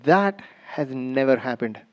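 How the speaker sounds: a deadpan wife, slow and quiet; flat denial. Retold or imitated. Wife flatly denying it to her husband, low and slow. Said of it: "She said".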